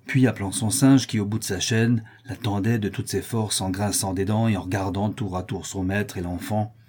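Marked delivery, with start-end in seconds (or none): none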